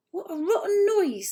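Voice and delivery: high, loud disgusted creature voice; disgusted creaker. A narrator's reading. Sniff complaining about Lucy's crying, high and loud. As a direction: recoil from an awful noise. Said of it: "said Sniff, plugging his floppy ears".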